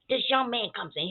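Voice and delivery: usual speaking voice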